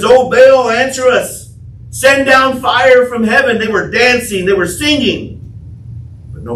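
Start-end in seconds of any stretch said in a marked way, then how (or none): 0.0-3.6 high, pleading call to Baal, drawn out slowly; mock pleading cry. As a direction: cry up to the heavens as a desperate prophet. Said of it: "They were screaming to the heavens".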